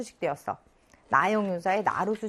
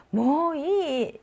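뭐 이 stretched very slowly and high; long, lilting demo of 뭐 이; softened refusal; 6 semitones higher, much slower than usual. Shown as a demonstration. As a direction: draw out 뭐 이 playfully. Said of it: "말투나 억양에 따라 좀 달라지지".

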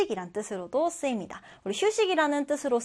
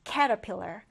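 caterpillar with CAT stressed first; 2 semitones lower, 3 dB quieter, much slower than usual. Shown as a demonstration. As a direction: punch CAT in caterpillar, the rest light. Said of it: "첫 음절의 강세를 두고". (caterpillar 첫 음절 CA에 강세)

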